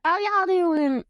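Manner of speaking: slow, high, loud Texas drawl greeting; hammed-up Southern drawl, stretched slow and loud; Southern accent. Shown as a demonstration. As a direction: drawl it out like a Texan, big and friendly. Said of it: "Southern is like Texas".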